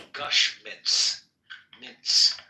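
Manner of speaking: "Mints" repeated with a slight lisp on the final S; lingering lisp on "Mints". Real, not acted. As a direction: test the lispy S at the end of "Mints". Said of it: "Does it sound like I'm lisping".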